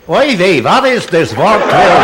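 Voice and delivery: put-on slow, kvetching Yiddish-accented engineer; Yiddish dialect. Engineer balking at warp twelve, Yiddish dialect. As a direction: complain in a comic Yiddish accent. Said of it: "they had picked some other dialect".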